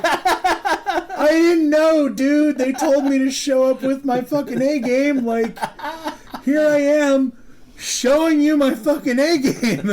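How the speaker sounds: put-on a cornered kid talking fast to cover himself; nervous squeaky excuse. Nervous self-defense, retold.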